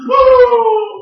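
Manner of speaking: loud, high mock wail; hammed-up howl of weeping; imitated sudden crying. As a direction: burst into an exaggerated wail. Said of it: "orang yang nangis mendadak".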